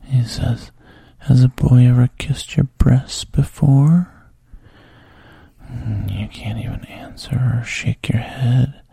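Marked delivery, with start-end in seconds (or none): 1.2-4.1 voiced, louder coaxing lines breaking out of the whisper; coaxing man. The man reassuring Bridget, louder coaxing voice. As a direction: lean in and reassure her out loud. Man's quoted question.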